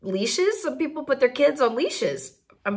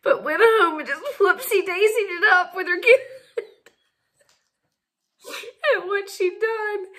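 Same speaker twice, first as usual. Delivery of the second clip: laughing while telling; 7 semitones higher than usual. Real, not acted. Teller laughing at the story.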